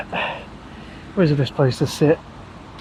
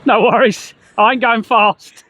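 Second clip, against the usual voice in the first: raised voice, called across the road; calling out; 6 semitones higher, 5 dB louder than usual. Real, not acted. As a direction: shout it over to someone nearby. Calling out to passing traffic.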